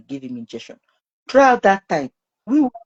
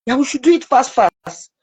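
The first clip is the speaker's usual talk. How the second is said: retold anxious urging; 7 semitones higher, 4 dB louder than usual. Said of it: "where she is crying".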